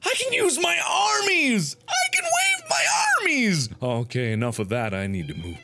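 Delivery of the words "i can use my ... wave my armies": put-on very high, childish gleeful squeal; giddy squeal. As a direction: squeal with silly delight, climbing higher. Gleeful discovery of his arms.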